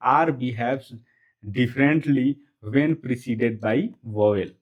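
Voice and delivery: very slow, word by word; deliberate, spaced-out English recitation; slow rule recital. Shown as a demonstration. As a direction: dictate the rule with gaps between words.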